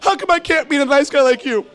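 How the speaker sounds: high, whining mock female voice; female friend. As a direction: imitate a friend lamenting her love life. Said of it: "She's like".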